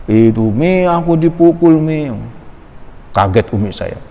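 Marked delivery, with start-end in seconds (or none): 0.5-2.1 put-on high, drawn-out child's whine; mock tearful complaint. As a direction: imitate a child tattling tearfully to grandma. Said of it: "saya nangis".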